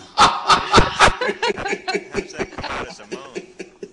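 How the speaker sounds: high, helpless burst of laughter; real laughing fit, high and drawn out; long laughter. Real, not acted. Comedian joking about a guest's laugh, long real laughter.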